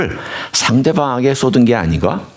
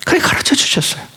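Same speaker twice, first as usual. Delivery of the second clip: ordinary talk, nothing marked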